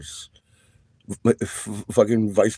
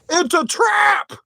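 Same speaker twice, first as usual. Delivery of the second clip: high, slow, hammed-up alarm cry; theatrical high "It's a trap!"; mock dramatic warning; slower than usual. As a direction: shout it like a movie alien admiral.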